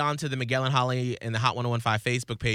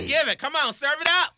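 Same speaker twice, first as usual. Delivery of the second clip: hyped-up cheering; 12 semitones higher, 4 dB louder than usual. Real, not acted. Cheering singer on.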